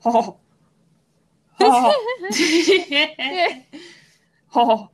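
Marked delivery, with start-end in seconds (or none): 1.6-4.9 Hank Hill attempt. Host asked to do Hank Hill, tries a few lightly voiced ohs. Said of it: "It's not very good".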